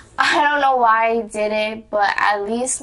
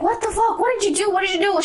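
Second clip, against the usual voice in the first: imitating mom, much higher and shocked; 11 semitones higher, faster than usual. Mom sees the new tattoo, high shocked imitation. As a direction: squeal it as the stunned mother.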